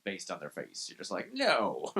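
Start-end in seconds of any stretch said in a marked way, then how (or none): 1.3-2.0 high, loud haughty refusal; hammed-up royal snob turning someone down; entitled asshole king. Dating app user rejecting faces, entitled king voice. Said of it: "like this entitled asshole king".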